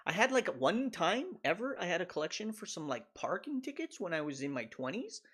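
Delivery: ordinary talk, nothing marked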